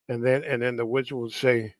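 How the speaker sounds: ordinary talk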